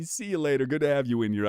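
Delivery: usual speaking voice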